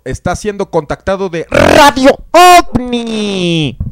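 loud, drawn-out show announcement; big announcer reveal. Real, not acted. Host tells Alejandro he is live, booming announcer call. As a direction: boom it out slowly, rising high and loud.

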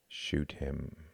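low, soft, flat order. A narrator's reading. Jorgen repeats the order to Klaus, flat voice. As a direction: repeat the command without any feeling. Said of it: "his voice flat".

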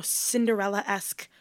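ordinary talk

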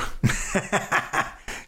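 high, breaking-up laugh; real laugh. Real, not acted. Father telling how she's asleep in the tub, high laugh. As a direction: laugh helplessly at your own story.

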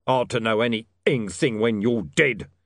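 loud snarling threat; snarled. Acted. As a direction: snarl it out menacingly. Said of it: "snarled Mister Tulip".

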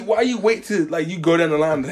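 ordinary delivery

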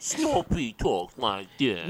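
slow, soft mock cartoon dog voice; Droopy Dog. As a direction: drag it out like a droopy hound. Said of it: "I think he's doing Droopy".

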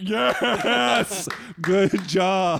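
loud excited crowd cheer. Retold or imitated. Retold audience cheering him.

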